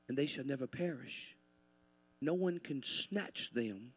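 soft, low scripture delivery with long pauses; measured quiet assurance, letting each phrase land; hushed, solemn preaching. Real, not acted. Preacher assures believers no one can snatch them, hushed.

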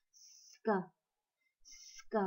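breathy whispered "Sk" with a long s before the k; voiceless sk cluster demo. Shown as a demonstration. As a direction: hiss the s, then pop the k. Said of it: "lấy hơi cái chữ sờ trước".

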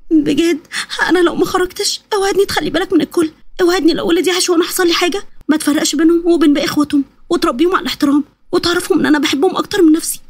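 high, tearful plea; fearful pleading. Acted. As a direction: beg him as if saying farewell. Frightened mother begging before giving birth.

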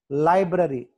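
Library with a loud "lai"; library, strong start, falling end; stress at the L. Shown as a demonstration. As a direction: punch the first syllable of library. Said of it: "L దగ్గర stress mark".

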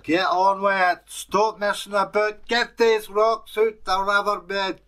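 forceful slave-driver shout; bossy captor. A narrator's reading. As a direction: drive the creature on with a loud threat. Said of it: "the guy said".

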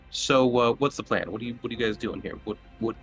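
ordinary delivery

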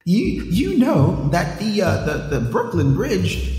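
put-on high-pitched old-timey pitchman; old-timey salesman. Con man selling the bridge to a newcomer, old-timey voice. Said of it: "let me brush up my old-timey".

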